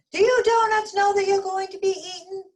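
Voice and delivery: high, loud call across the bakery; yelling. Acted. The baker yells to the other donuts, high loud call. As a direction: yell it out to the back. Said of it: "The baker yelled to the other donuts".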